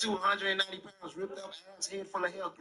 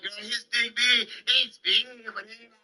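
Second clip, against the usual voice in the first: put-on higher, slower girlfriend gossip; women gossiping to each other, higher and slower; mock gossiping woman; 6 semitones higher, 5 dB louder than usual. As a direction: play the girlfriend dishing about a man. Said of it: "They be like".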